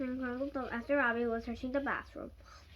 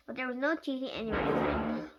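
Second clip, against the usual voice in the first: ordinary delivery, nothing marked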